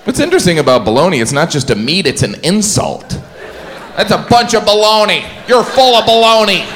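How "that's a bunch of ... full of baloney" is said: high, emphatic put-on accuser; hammed-up, high-pitched scoffing; mock accusation. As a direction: throw the insult in a high voice. Acts out the insult.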